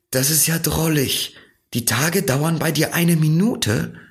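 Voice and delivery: put-on high, bright-eyed child marvelling; a wondering little boy, lifted high; Little Prince voice. A narrator's reading. (kindlich staunende Stimme des kleinen Prinzen)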